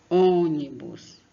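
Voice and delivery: ônibus very slow and loud, leaning on the first ô; stress on ô. Shown as a demonstration. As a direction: draw out ônibus on the ô. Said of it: "A sílaba aqui, no caso, é só o O". (tônica no ô, bem devagar)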